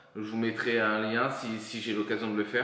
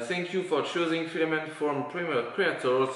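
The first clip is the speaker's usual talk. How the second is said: French speaker's hammed-up English accent; showy English accent; 3 semitones higher than usual. Shown as a demonstration. Vlogger reading a leaflet aloud in showy English accent. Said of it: "C'est un super accent anglais".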